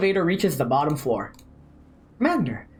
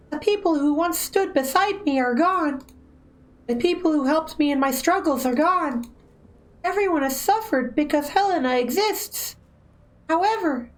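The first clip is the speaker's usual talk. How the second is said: Helena's voice; 8 semitones higher than usual. Acted. Streamer voicing Helena's sad lines.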